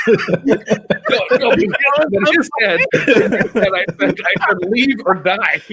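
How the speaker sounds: high, loud, laughing through the words; laughing at his own threat. Real, not acted. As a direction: giggle while retelling the threat. Laughing while retelling threat.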